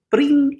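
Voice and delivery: high, ringing "bling"; sudden high-pitched phone chime; imitated phone ring. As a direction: mimic a phone ringing on one high note.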